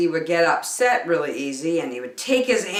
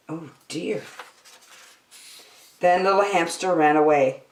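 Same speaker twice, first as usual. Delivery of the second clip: plain reading voice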